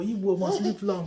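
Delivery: normal talking voice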